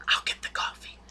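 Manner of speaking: whisper. Retold or imitated. Hank escaping awkwardly, hushed.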